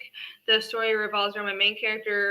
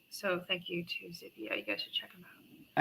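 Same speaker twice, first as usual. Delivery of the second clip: whispering; 3 semitones lower, 11 dB quieter than usual. Real, not acted. Whispered thanks to musician.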